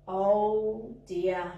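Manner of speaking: normal talking voice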